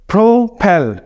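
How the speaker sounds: "Propel" as pro-PEL, weight on the last syllable; second-syllable stress. Shown as a demonstration. Teacher models the verb for learners, "propel" stressed pro-PEL. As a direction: sound out "propel" leaning on PEL. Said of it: "propel second syllable को इसलिए stress".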